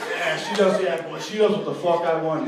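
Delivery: high, shouted macho bragging; loud boasting. Acted. Deluded guy brags about his girl, shouted boast. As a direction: crow it at full volume.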